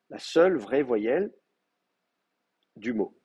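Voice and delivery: normal talking voice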